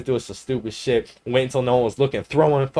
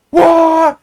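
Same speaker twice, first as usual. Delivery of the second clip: very high, loud holler; kicking yell; 11 dB louder than usual. Retold or imitated. Friend kicking the dispenser loose, kicking yell. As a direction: holler it as you strike. Acts kicker's yell mid-kick.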